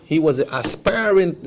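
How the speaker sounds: normal talking voice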